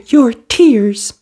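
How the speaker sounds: high, loud snap from a fiery fairy; snapping fairy. A narrator's reading. Fiery fairy snapping at crying Cinderella. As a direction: bite the words off sharply. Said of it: "snapped the fairy".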